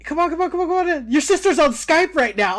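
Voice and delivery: insistent re-enacted beckoning; eager urging, imitated. Mom hurrying the guest to a Skype call, eager urging.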